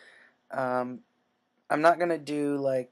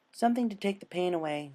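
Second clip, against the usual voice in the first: higher sweet pony voice; Pinkie soothing; 5 semitones higher than usual. Acted. Pinkie tells Dash about the drug, higher and sweet. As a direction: coo it like a creepy nurse.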